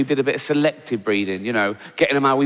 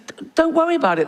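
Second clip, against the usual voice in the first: breezy reassurance; faster than usual. Retold or imitated. Reassuring girlfriend, retold.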